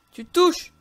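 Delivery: high, loud burst; urgent gamer yell; sudden shout. Real, not acted. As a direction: explode out of a whisper. Sudden outburst during fight.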